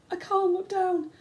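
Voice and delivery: high, loud fearful plea; frightened cry. A narrator's reading. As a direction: cry out too scared to look. Frightened boy.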